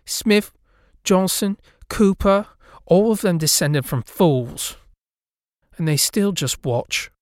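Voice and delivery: high, loud name-calling; scornful ghost girl. A narrator's reading. Ghost girl pointing at houses, scornful and loud. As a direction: spit out each family name. Lydia naming families with contempt.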